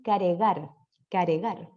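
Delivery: "caregar" with an e between r and g, slowed; inserted vowel. Shown as a demonstration. Teacher showing adults' faulty pronunciation. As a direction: drag out "caregar", ca-re-gar. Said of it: "en vez de decir cargar".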